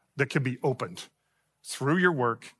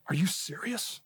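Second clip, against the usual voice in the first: hushed, higher-pitched mock excitement; retold excited disbelief; 4 semitones higher, 7 dB quieter than usual. Coordinator reacts to hearing he's a magazine editor, hushed excitement. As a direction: imitate her pleased surprise, soft and high. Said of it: "Why are you so excited about this?".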